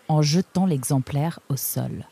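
ordinary delivery

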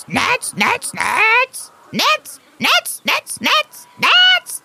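very high, squeaky parrot voice; squawking parrot. Acted. Perroquet réclame des noix. (voix de perroquet très aiguë)

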